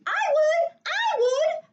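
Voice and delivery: put-on high, loud eager child's shout; excited yell. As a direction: yell out to be picked first. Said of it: "I yelled out".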